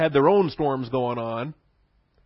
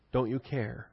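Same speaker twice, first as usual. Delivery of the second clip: softer, hurt little don't you care; a quieter hurt version of the question; quiet plea; 8 dB quieter, slower than usual. Shown as a demonstration. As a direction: ask it softly, wounded rather than angry. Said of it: "The same language, same word".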